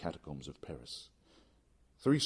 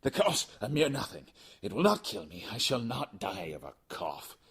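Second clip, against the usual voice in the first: higher, louder character voice for the sick friend; voiced Fortunato; 5 semitones higher, 6 dB louder than usual. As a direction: play the coughing friend brushing it off.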